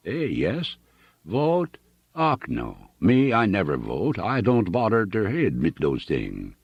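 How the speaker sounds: put-on stubborn, homely German accent; a plain German farmer insisting, heavy accent. Hooven tells Annixter he never votes, German accent.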